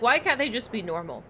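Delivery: ordinary talk, nothing marked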